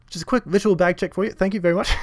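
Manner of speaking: high, loud, brisk usher voice; imitating ticket guy. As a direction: mimic an over-polite, eager bag checker. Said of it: "and he's like".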